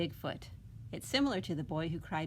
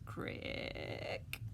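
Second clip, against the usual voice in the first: twig snap sound; 7 dB quieter than usual. Acted. Sound from the forest.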